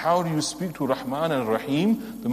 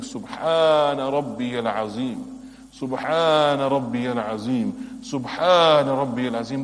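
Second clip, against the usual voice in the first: slow, louder, deliberate praise; slow devout recitation; 4 dB louder, slower than usual. Shown as a demonstration. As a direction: savour each word of praise to God. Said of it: "when you are in ruku and you're saying".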